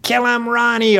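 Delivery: high-pitched holler from the stands; egging-on yell. Acted. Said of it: "our neighbors yell".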